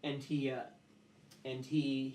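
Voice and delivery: ordinary delivery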